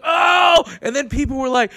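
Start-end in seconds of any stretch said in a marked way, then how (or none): 0.0-0.6 loud, high whooping "oh"; crowd's reaction. Retold or imitated. Crowd reacts to the roast, mock uproar. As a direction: imitate a room erupting at a burn.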